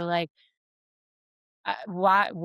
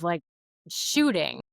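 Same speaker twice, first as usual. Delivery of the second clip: normal talking voice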